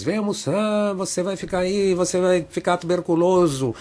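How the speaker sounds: high, far-off mother's call, sustained throughout; high-pitched mock scolding; nagging mother. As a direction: imitate a worried mum calling through a door. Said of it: "a minha mãe batia na porta".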